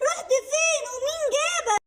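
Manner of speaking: a mother half sobbing with relief; tearful relieved mother. Acted. Mother relieved rabbit is back.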